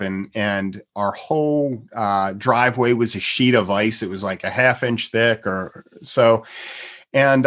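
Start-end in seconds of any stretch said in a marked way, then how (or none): none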